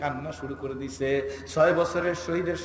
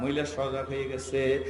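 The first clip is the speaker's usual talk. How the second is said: ordinary talk, nothing marked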